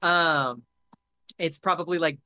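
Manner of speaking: normal talking voice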